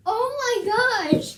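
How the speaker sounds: slow, high, loud oh my gosh; thrilled, drawn-out oh my gosh; excited gasp. Real, not acted. Thrilled by the cat translator.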